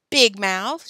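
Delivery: loud, angry jeer; shouted. A narrator's reading. Alan snaps at Joe from the horse stall, loud shout. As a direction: shout it as an insult. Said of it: "shouted Alan from the horse stall".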